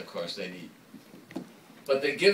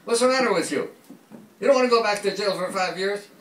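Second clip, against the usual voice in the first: raised, exasperated rebuke; mild mock telling-off, pitched up; scolding group; 7 semitones higher, 4 dB louder than usual. Patients ganging up on Robin, lightly exasperated.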